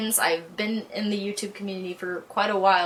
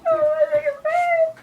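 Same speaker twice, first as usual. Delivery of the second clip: much higher, wailed mock crying; wailing mock sob; 3 dB louder than usual. Fan saying goodbye to the series, wailing mock sob. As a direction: wail it like a hammed-up breakdown.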